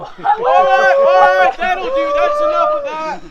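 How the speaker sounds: loud, high-pitched shout over the noise; shouting them down. Acted. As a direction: cut the rowdy boys off at full voice. Breaking up the rowdy teasing.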